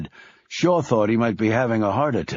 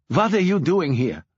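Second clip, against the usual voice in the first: sharp, higher demand from the mother; 7 semitones higher than usual. A narrator's reading. Mother confronts Shaw in the apartment, demanding. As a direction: confront him at the door. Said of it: "demanded Natasha".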